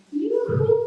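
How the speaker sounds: high sing-song call across the room; sing song yoo hoo. Real, not acted. Calling out to arriving friend.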